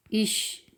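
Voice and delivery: इश ending in a soft "sh"; CH as sheen. Shown as a demonstration. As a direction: say इश with a hushing "sh" for CH. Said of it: "आवाज़ हमेशा शीन की आएगी".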